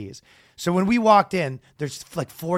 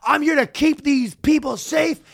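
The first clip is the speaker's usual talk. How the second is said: yelling; 10 semitones higher than usual. Retold or imitated. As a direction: yell it to the crowd. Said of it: "So he's yelling".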